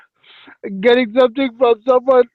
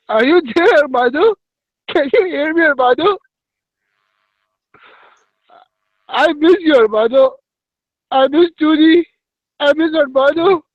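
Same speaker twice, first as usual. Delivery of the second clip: higher-pitched, pretend pining for a lost friend; mock plaintive calling; 6 semitones higher than usual. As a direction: call out as if missing someone. Plaintive mock call to absent friend.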